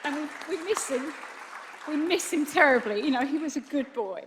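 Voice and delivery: loud, high, tearful cry; real grief, pushing the words out through sobs. Real, not acted. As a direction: voice breaking through tears. Grieving mother speaking of son.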